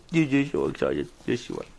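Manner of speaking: dropped-down coo to the dog. Real, not acted. Baby talk to dog, low take.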